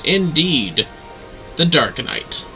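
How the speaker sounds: normal talking voice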